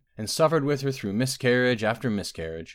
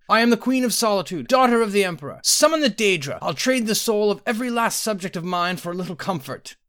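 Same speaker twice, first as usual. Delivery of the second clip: high, loud royal outrage; snarling queen; 12 semitones higher, 6 dB louder than usual. A narrator's reading. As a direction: rage at the healer, commanding and high. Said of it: "she snarled".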